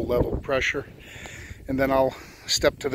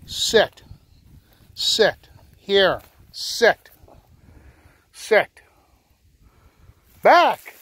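loud, high dog commands; shouted commands; 6 semitones higher, 5 dB louder, much slower than usual. Real, not acted. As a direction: bark sharp orders across the field. Commanding a retriever at distance.

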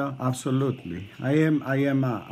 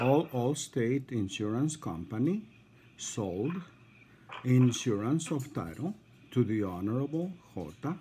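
measured phrases with pauses between; careful, spaced-out delivery; deliberately slow; 3 dB quieter than usual. Real, not acted. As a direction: spell it out slowly for the listener. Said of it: "I'm gonna go slow".

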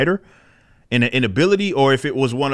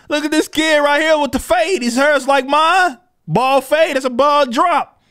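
can't believe this is real; worked-up disbelief; 11 semitones higher than usual. Real, not acted. Showing viewers the absurd comic page, excited and incredulous.

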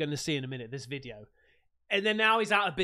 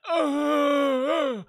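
louder imitated groans of a broken bone; pained groans; 6 semitones higher, 8 dB louder than usual. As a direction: groan like you've just snapped something. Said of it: "you've broke a bone or something".

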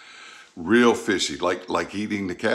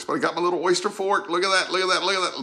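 excited; 9 semitones higher than usual. Real, not acted. Showing off tiny fork.

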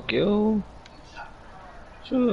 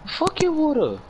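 scoffing retort. Real, not acted. Gamer scoffing at a friend who stopped to drink water. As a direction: brush off his excuse.

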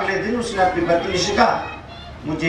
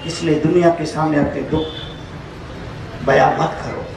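ordinary delivery

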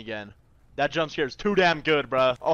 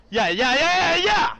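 excited; 12 semitones higher, 10 dB louder than usual. Real, not acted. Excited cheering.

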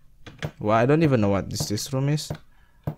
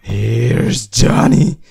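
slow drawn-out Here's Johnny, louder; hammed-up menacing call, lightly voiced; mock Shining taunt; 9 dB louder, much slower than usual. Quoting The Shining again.

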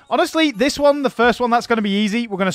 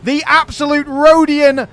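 loud, furious ranting at fleeing enemies; angry rant; 5 semitones higher, 4 dB louder than usual. Real, not acted. Gamer ranting at retreating AI armies, real furious shouting. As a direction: yell in real frustration, raised and hot. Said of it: "I wasn't expecting to get such a rant in today".

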